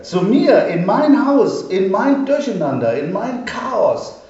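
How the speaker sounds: high, loud, stressing each mein; thrilled, piling up the places; rising eager calling out, slowing on the last; excited exclaiming. A narrator's reading. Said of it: "rief er".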